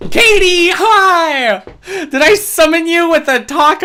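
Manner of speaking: high, loud greeting; excited greeting. Real, not acted. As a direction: greet a friend with delight. Greeting a viewer in chat.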